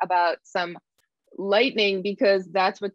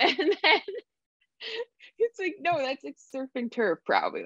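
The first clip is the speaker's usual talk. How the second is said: laughing; 8 semitones higher than usual. Real, not acted. Laughing retelling coach's answer.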